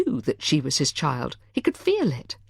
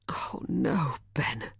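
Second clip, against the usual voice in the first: shocked whisper; 7 dB quieter, much slower than usual. A narrator's reading. Hearing the betrayal.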